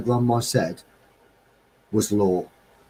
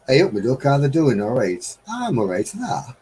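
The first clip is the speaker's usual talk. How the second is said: a light broad Midlands greeting; Midlands dialect; 3 semitones higher than usual. Shown as a demonstration. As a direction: greet like someone from home. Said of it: "talk like where I come from".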